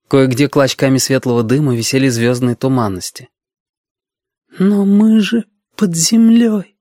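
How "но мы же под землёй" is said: put-on high-pitched girl at a loss; lost, bewildered girl. A narrator's reading. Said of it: "тихо, потерянно сказала Люся". (растерянный высокий девичий голос)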